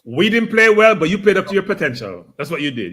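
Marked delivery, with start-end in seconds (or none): none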